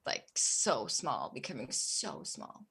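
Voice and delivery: low, slow, fading down to a whisper; shrinking, defeated. Real, not acted. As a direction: let the voice shrink with the words. Describing feeling herself shrink.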